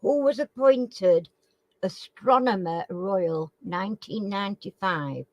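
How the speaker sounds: ordinary delivery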